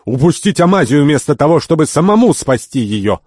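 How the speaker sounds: high, loud outrage; angry shouting. A narrator's reading. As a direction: shout it as a furious rebuke. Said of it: "закричал господин Сафар".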